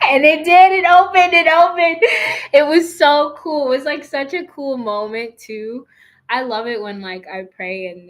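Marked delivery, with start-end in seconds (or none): 0.0-5.9 high, loud, triumphant; thrilled. Real, not acted. Telling viewers the prayer worked, loud and high with joy. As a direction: announce the win with real joy. The stubborn shower head finally opens.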